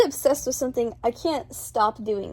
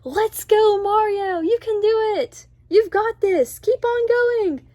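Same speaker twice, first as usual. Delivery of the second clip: high, loud cheering at the screen; whooping fan cheer, pitched way up; retold excited screaming; 10 semitones higher, 4 dB louder, slower than usual. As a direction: re-enact yelling encouragement to a movie hero. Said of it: "I kept on screaming stuff like".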